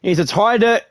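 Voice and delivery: normal talking voice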